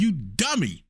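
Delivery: usual speaking voice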